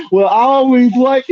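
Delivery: high, slow sugary woman's voice; mimicking a woman. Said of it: "if you hear".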